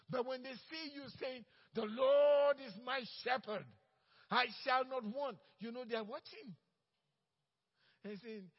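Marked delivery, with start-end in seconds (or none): 1.7-5.4 loud, slow declaration of faith; a believer declaring the psalm aloud to coworkers, loud and slow; bold proclamation. Acted. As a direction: proclaim it boldly. Believer proclaiming scripture at work.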